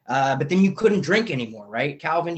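ordinary delivery

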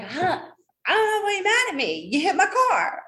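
high, loud, incredulous protest; indignant retelling. Retold or imitated. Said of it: "So I was like".

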